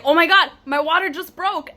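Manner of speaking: high, loud re-enacted outburst; retold alarm. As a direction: blurt it out like a startled mother-to-be. Retelling her water breaking.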